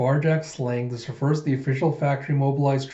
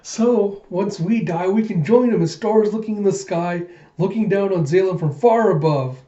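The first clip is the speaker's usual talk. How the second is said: high, louder starry-eyed voice; dreamy-eyed girl; 6 semitones higher, 4 dB louder than usual. Acted. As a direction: gush about joining the stars. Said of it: "And then she's just, like".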